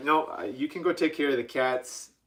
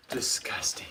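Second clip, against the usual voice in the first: whispered disgust; 6 dB quieter than usual. Real, not acted. Finds mouse poop on board.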